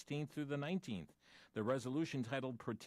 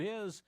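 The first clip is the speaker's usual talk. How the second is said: "his" said high and loud, set apart as a quoted word; stress on "his"; 9 semitones higher, 4 dB louder than usual. Shown as a demonstration. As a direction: lift "his" up sharply as if in quotes. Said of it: "I shouldn't have said that word".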